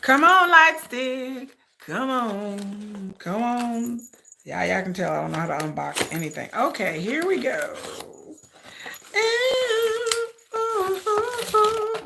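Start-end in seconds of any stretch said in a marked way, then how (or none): 0.0-4.0 slow, drawn-out pleading with an object; coaxing. Real, not acted. Coaxing a stuck box open. 9.1-11.9 high-pitched string of oohs; drawn-out high ooh of wonder; excited high oohs. Real, not acted. Opening the light stick box.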